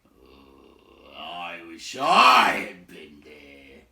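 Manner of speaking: low gruff mutter. A narrator's reading. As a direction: grumble it like a restless bear. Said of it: "strode about and muttered".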